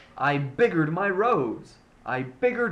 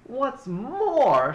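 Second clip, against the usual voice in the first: sharp snap, higher and louder; snapped; 8 semitones higher, 4 dB louder than usual. A narrator's reading. Lorax snapping at the Onceler, a higher, louder snap. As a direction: snap it out, dander up. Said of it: "snapped the Lorax".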